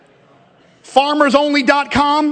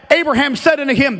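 ordinary delivery

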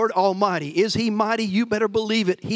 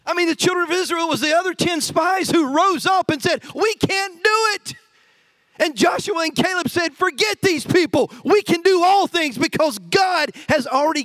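high, driving sermon voice; fired-up preaching; 7 semitones higher than usual. Acted. Pastor rallies church against naysayers, fired up.

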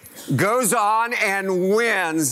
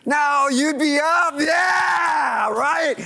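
excited shout; 7 semitones higher, much slower than usual. Acted. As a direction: whip the crowd up with a big shout. Pastor shows crowd cheering.

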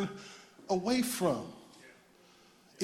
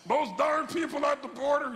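higher-pitched mock outrage; mock indignant complainer; 9 semitones higher than usual. Mocks an angry complaint about strangers.

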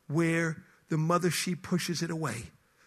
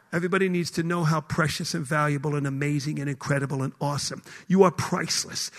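ordinary talk, nothing marked

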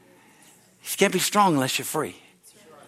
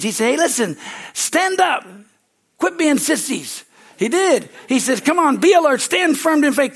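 loud, high, driving preaching; preaching at full force; 7 semitones higher, 5 dB louder than usual. Real, not acted. Preacher telling Christians not to quit, loud and high. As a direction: push it like a revival preacher.

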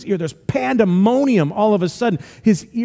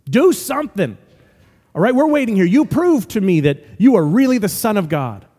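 impatient, raised-pitch demand for proof; mock challenge; 3 semitones higher, 3 dB louder than usual. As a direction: act out a demanding skeptic. Acts Pharisees demanding a sign.

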